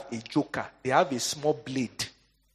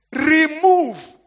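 "Remove." belted out much higher and louder; shouted emphasis; 13 semitones higher, 11 dB louder than usual. Acted. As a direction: hurl the word "Remove." at the crowd. Preacher stresses the key word.

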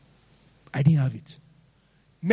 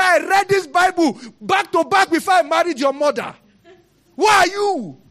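angry father; 8 semitones higher than usual. Retold or imitated.

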